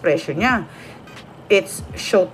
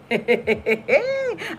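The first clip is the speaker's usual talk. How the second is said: soft, high run of laughter; high cackle; 7 semitones higher, 5 dB quieter than usual. Real, not acted. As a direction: giggle rising into a squeal. Laughs at own remark. (matinis na halakhak)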